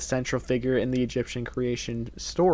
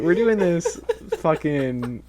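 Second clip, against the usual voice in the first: laughing; 4 semitones higher than usual. Real, not acted.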